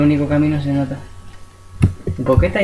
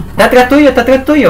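loud, high urgent warning; urgent shout; 8 semitones higher, 6 dB louder than usual. Real, not acted. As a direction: yell the warning, fast. Urgent warning during horror game.

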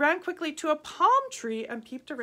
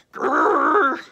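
loud rumbling growl; tiger growl; 4 semitones higher, 13 dB louder than usual. A narrator's reading. Tigers answering the boy with a loud growl. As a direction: growl back angrily. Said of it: "they could only say".

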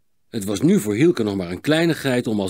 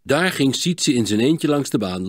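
plain reading voice

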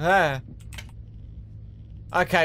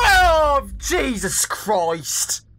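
usual speaking voice

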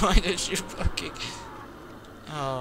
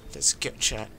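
low, soft frightened murmur; quiet and afraid, whispering to himself; scared hush; 3 semitones lower, 8 dB quieter than usual. Real, not acted. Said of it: "I'm so afraid".

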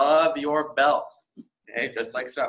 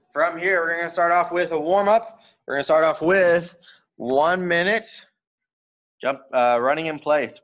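loud, raised class callout; coach calling drills; 4 semitones higher, 6 dB louder than usual. Acted. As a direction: call the drill to the whole room. Coach calls out warm-up drill.

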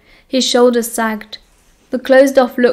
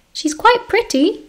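much higher, slow timid voice; meek; 11 semitones higher than usual. A narrator's reading. Peter timidly suggests Narcissa, meek. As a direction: shrink as you offer it. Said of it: "Peter said meekly".

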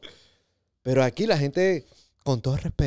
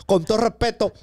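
higher, louder sing-song street robber; mock robber; 7 semitones higher, 3 dB louder than usual. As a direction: taunt the victim like a polite carjacker. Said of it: "te van a robar".

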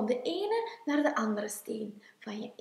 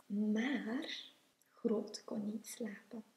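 ordinary delivery

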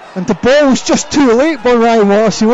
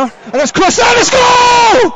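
high, drawn-out goal yell; goal shout; 12 semitones higher than usual. Real, not acted. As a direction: roar out the goal call. Goal celebration in live commentary.

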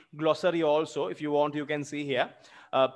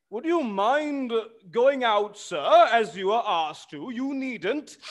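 pleading servant; 6 semitones higher than usual. Acted. Servant voice in play.

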